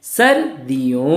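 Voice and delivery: सर्दियों slow and stretched: sar-di-yon; model pronunciation. Shown as a demonstration. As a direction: model सर्दियों slowly for the class. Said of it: "एक बार फिर से बोलिए". (सर्दियों को धीरे, साफ़ खींचकर बोलें)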